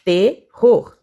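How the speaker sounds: "Terror" split loudly into te, rror; syllable by syllable. Shown as a demonstration. Teacher showing two syllables, "Terror" broken in two. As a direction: punch both halves of "Terror" separately. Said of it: "duas sílabas".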